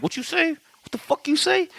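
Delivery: high-pitched, affronted challenge; mock outrage. Hans rushing up to Holly after her insult, mock outrage. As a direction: squeal it like someone confronting an insult.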